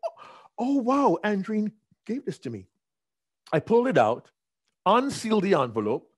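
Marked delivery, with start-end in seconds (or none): 0.1-2.7 high, delighted discovery; sudden high-pitched delight; excited. Retold or imitated. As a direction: gasp of surprised joy at the card.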